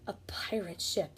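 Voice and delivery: hushed whisper. A narrator's reading. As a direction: breathe it out secretly. Hushed narration moment.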